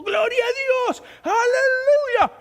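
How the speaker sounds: high, loud, drawn-out praise shout; imitated pastor's piercing, stretched-out cry; shouting evangelical pastor. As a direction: holler praise like a radio preacher. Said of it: "el pastor evangélico gritando".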